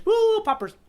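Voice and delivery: high, bright woo for the occasion; mock party cheer. Real, not acted. Cheering chapter fifty-one with a high party whoop. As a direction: pop the confetti, squealing high.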